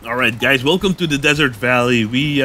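ordinary delivery

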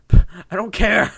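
loud, drawn-out, high protest; defiant shout. Real, not acted. Gamer to critics of his pronunciation, defiant joking shout. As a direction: yell it slowly, laughing it off.

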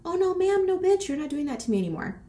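usual speaking voice

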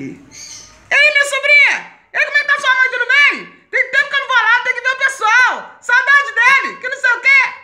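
shrieked, high-pitched imitation of an uncle; tio escandaloso falando alto. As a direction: holler a greeting across a bus. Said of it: "só fala alto". (imitando o tio aos gritos, bem agudo)

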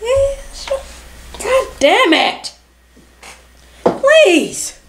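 put-on high, pleading little-girl voice; little kid voice. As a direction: beg like a small child. Keeps the child voice.

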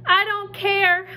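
high, loud, slow holler. Acted. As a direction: holler back, upset and stubborn. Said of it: "I hollered".

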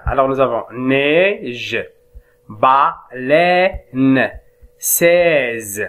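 "neige" drawn out very slowly, then "baleine", "seize"; slow French model reading. Shown as a demonstration. Teacher modelling French words slowly for the class. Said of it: "Très bien".

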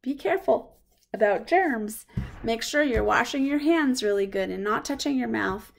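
plain reading voice

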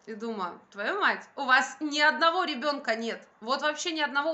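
ordinary delivery

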